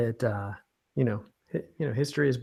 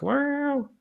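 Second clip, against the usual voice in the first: high exasperated well; 14 semitones higher than usual. Retold or imitated. Cousin's reply in retold exchange.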